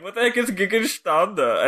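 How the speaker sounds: slow, high mock German question; hammed-up German accent; fake German. Shown as a demonstration. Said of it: "I'm just doing a fake German thing".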